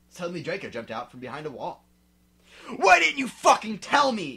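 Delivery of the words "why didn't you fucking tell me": high, loud furious shout. Acted. Draco shouting at his girlfriend, furious. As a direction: blast the question in fury. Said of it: "he shouted".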